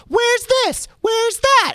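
put-on very high, loud kid screech; screaming kids. Performer mimicking the interactive show's audience, screaming like kids. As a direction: imitate children shrieking at the stage. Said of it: "the kids are screaming at you".

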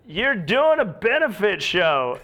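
high, drawn-out mock yelling; mock heckler yell. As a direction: imitate an outraged heckler, slowly stretched out. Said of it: "starts yelling at me, going".

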